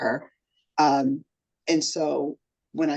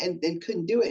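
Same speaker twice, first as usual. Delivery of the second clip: normal talking voice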